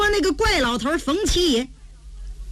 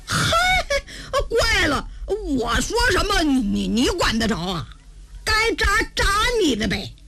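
lightly voiced girl, bickering back; a hint of brazen, scatterbrained backtalk; cheeky crazy girl; 5 semitones higher than usual. (疯丫头略带顶嘴的口气)

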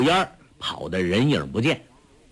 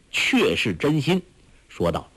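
ordinary delivery

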